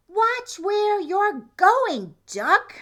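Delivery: high, loud, slow squawking scold; cross chicken. Acted. Chicken nearly run over, scolding Duck high and loud. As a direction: scold like an indignant hen.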